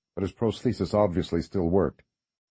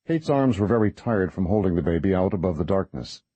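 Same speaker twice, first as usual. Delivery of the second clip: ordinary delivery, nothing marked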